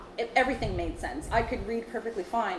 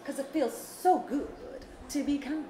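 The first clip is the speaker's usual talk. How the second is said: Auntie B voice; 4 semitones higher than usual. Pretend. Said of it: "In your Auntie B voice?".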